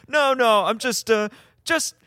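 hammed-up nervous cover-up; flustered backpedaling. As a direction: backpedal badly, starting high and stumbling. Acting out Sakath's flustered backpedaling.

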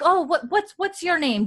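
high-pitched put-on greeting; friendly brothel lady. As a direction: ask eagerly in a high character voice. Brothel lady greets newcomer, acted.

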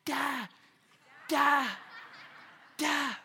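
a hint of a baby calling da; toddler babble. Even little [name] ... he's going.

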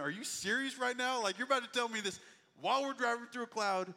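acted-out stunned protest; incredulous. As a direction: question him in mounting disbelief. Passenger reacts to pilot's confession.

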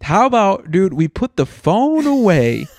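mock scolding. Real, not acted. Scolding his friend for looking at his phone, slow and hammed-up. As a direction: tell him off for the phone, half joking.

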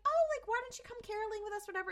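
high, quick neighbor voice; imitated sociable neighbor; chirpy neighbor. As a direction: rattle off a friendly invitation up high. Neighbor's friendly invitation acted out.

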